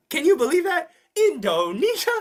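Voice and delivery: high, loud mock-incredulous question; mock disbelief. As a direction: act amazed, pitch jumping up. Feigned amazement at exam requirement.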